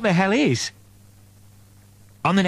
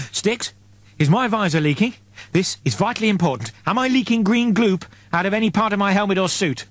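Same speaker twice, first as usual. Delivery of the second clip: urgent, higher questions; anxious; 6 semitones higher than usual. A narrator's reading. Eddie urgently asks drone about his leaking helmet, anxious. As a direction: worry about leaking gloop.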